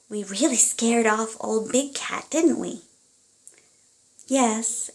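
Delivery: lively storybook reading aloud; reading to children. Acted. Parent reading a picture book to a child, animated storytelling. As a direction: read it like a bedtime story to little ones.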